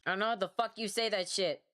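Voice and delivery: ordinary talk, nothing marked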